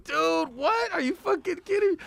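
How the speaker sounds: high, incredulous dude what; retold disbelief. Him and [name] reacting together.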